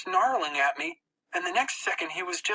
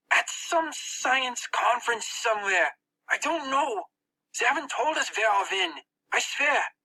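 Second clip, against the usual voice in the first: put-on higher, pleading boy voice; frightened boy; 9 semitones higher than usual. Max pleading with the narrator who holds his arm. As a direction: beg to be believed.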